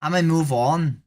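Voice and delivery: put-on Irish accent greeting; chirpy fake Irish brogue. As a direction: say hello like a cheery Irish local. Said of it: "Irish accent for the rest of the stream".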